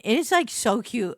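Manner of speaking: ordinary delivery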